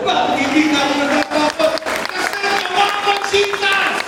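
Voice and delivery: high, loud fervent preaching; preaching at full force. Real, not acted. Pastor exhorting the congregation not to prove themselves, preaching loudly. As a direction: lift the room, pour it out.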